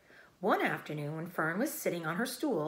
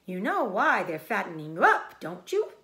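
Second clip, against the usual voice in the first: put-on old sheep voice; 7 dB louder than usual. Old sheep hinting at bad news, loud and sly. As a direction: tease the secret out slyly.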